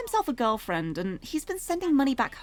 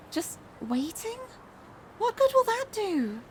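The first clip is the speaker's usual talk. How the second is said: doubtful Hitomi; 11 semitones higher than usual. Acted. Frustrated Hitomi doubts Achi's waiting plan, high doubtful voice. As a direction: question the plan in a high character voice.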